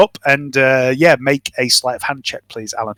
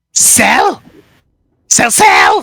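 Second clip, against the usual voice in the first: creature cry; 7 semitones higher, 3 dB louder, much slower than usual. Acted. Sel calls to Baz.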